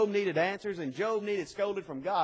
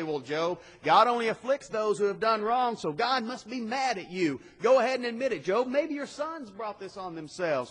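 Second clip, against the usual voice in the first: accusing friends; 5 semitones higher, 4 dB louder than usual. Retold or imitated. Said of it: "they say".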